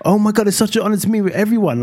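mock star-struck churchgoer; gushing churchgoer. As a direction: gush like an awestruck fan. Mimicking excited churchgoers.

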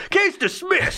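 high squeaky mock judge; a silly dismissive judge voice. Imagining a court tossing a skeleton case, squeaky judge. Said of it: "and they're like".

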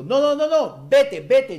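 high, loud retold panic; alarmed shouted plea, re-enacted; urgent warning. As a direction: cry out a warning, acting out the nightmare. Acting out warning friend in nightmare.

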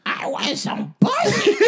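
high, slow squawky cartoon duck; Donald Duck voice. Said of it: "That was my Donald Duck impression".

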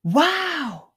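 high, wondering "Wow"; amazed duckling. Acted. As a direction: gasp it out in wonder at the reflection. Duckling sees reflection.

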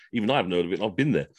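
ordinary talk

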